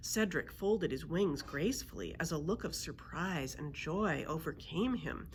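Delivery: plain reading voice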